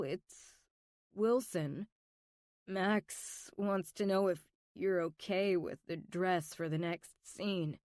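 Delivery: ordinary delivery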